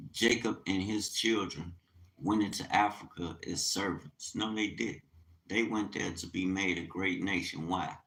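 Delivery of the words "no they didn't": ordinary talk, nothing marked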